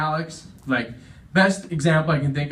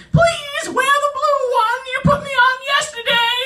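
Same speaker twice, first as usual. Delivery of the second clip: tiny buttonhole in a very high falsetto; high squealing begging; screaming for mercy; 3 dB louder than usual. Acted. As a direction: shriek a desperate plea, squeaky and small. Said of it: "stretched out buttonholes screaming for mercy".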